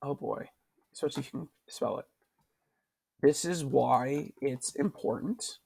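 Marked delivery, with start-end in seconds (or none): none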